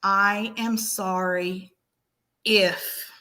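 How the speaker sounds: slow, deliberate "I am sorry", pause, then a separate "if"; measured demo with "if" set apart; stress on if. Shown as a demonstration. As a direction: spell out the apology, isolating "if". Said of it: "they do not say".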